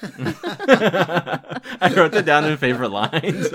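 slow, high giggling through the words; laughing too hard to speak; speaking through real laughter. Real, not acted.

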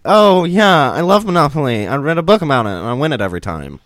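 high loud gushing fan; mock excited fan. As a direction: gush like a superfan. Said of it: "you're gonna be like".

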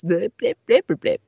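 high, loud blipping beeps; page turn blips. Acted. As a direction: beep like an old cassette. Page turn sound effect.

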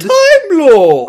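high, loud, drawn-out mock outrage; antagonistic mockery. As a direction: scoff at a title at full volume. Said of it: "I loved how antagonistic [name] was".

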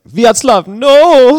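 put-on high, loud child's yell of alarm; high-pitched, drawn-out shout of protest; panicked kid. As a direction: scream it like a kid in the passenger seat. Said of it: "and the kid is like".

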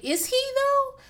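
high, incredulous question; skeptical doubt. Retold or imitated. Reacting to Renee's claim, asked in high skeptical disbelief. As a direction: doubt her claim openly. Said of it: "And I was like".

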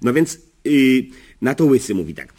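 usual speaking voice

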